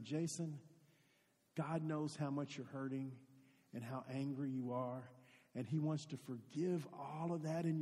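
put-on soft, tender words to a hurting boy; gentle comfort. Coach soothing the angry boy Jason, soft and tender. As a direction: ease it out quietly, arm around his shoulder.

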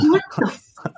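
high-pitched stunned disbelief, retold; retold losing it. Retelling the call from Triple J, losing it. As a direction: re-enact being floored by the news. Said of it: "I just lost it".

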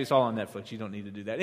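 usual speaking voice

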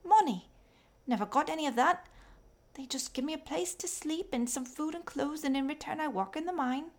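put-on high, thin, raspy little girl voice. A narrator's reading. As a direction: call out like a tired, skinny child selling coal. Fanny the mine girl speaks.

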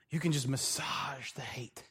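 soft, slow whisper; hushed, slow, conspiratorial whisper. Acted. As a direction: breathe it like a secret. Whispered aside about nursing anger.

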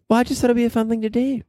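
high, fast, chirpy lady voice; interview lady. Acted. Wimbledon interviewer defending herself, high and chirpy. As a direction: answer back brightly in a high lady voice. Plays the interviewer answering back.

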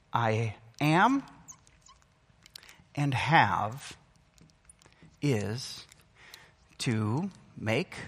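each word set apart by long pauses, quiet and low; preacher landing the principle word by word; slow emphatic pauses. Acted. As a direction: let every word hang alone. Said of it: "slow, spaced key principle".

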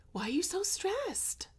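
high, soft mocking husband voice; soft, high-pitched impression of a spouse; imitating husband. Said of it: "my husband is like".

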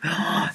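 quick, soft gasp of wonder; a small awed intake of breath; storyteller's gasp. Acted. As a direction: gasp as if amazed. Delighted storyteller gasp at the baking.

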